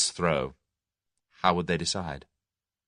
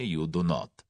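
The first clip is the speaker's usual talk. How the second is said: sullen; 3 semitones lower, 4 dB quieter than usual. A narrator's reading. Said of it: "admitted Boris at last sullenly".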